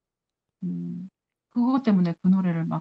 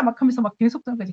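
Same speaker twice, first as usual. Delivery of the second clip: ordinary talk, nothing marked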